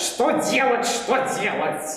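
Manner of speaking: mocking echo. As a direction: repeat her words like a parrot. Troll echoes queen.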